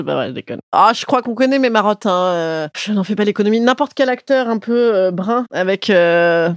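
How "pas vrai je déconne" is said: quiet aside. Real, not acted. Quick aside, dropped voice.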